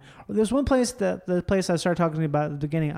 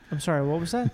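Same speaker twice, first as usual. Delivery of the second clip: normal talking voice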